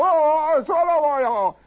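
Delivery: very high comic wail; hammed-up hollering. Man on third floor seeing his things trashed, high mock holler. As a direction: holler in exaggerated panic. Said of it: "he hollered".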